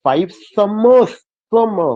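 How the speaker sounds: "five" light, "summers" swelled and stretched slowly; stress on SUMMERS. Shown as a demonstration. Teacher showing stress falls after five, "summers" stressed. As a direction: slowly push the weight onto "summers". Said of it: "five पे नहीं करना पड़ेगा लेकिन समर".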